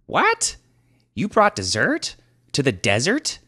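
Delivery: very high, loud squawk; incredulous. Acted. Thief reacting to dessert in the desert, incredulous. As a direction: question it in total disbelief.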